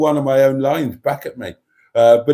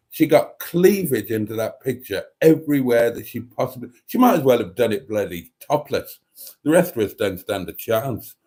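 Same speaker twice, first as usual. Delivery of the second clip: ordinary talk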